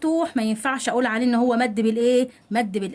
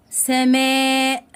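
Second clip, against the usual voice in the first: long held vowel, slightly louder; drawn-out model word; 4 dB louder, much slower than usual. Shown as a demonstration. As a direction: stretch the word out for the class. Teacher models example word. (نطق ممدود للكلمة)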